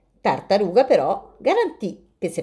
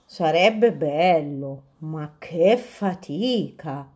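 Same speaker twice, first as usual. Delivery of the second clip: drowsy dormice; 2 semitones lower, much slower than usual. Acted. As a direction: yawn it out like sleepy dormice. Dormice reply sleepily.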